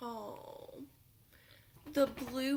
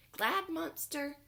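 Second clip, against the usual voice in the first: higher cheerful Glad Monster; bright Glad; 8 semitones higher, 3 dB louder than usual. Acted. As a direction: lift it up happily.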